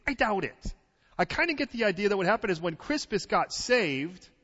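loud, high, driving sermon delivery; full-force preaching. Acted. Pastor drives the story of Paul home to the congregation, loud and urgent. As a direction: preach it out, pressing the crowd.

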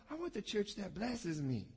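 usual speaking voice

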